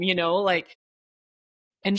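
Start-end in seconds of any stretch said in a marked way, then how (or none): none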